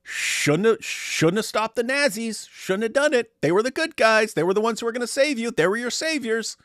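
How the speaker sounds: high-pitched, mocking quote; mocked conclusion. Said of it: "And what's the conclusion?".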